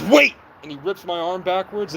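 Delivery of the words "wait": sudden loud high bark of a command; one sharp yelled word, mock officer; imitated shout. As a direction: shout it like a cop stopping you.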